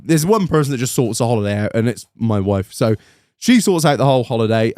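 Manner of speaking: ordinary talk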